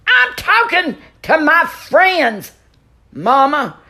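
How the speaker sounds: high, loud, exasperated yell down the stairs; yelling back at Mama. Acted. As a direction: shout it at your nagging mother. Yelling back at his mother.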